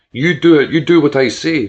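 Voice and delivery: loud, commanding outburst; furious shout. Acted. George slamming his fists, shouting orders at the doctor. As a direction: pound each word like a fist on the table.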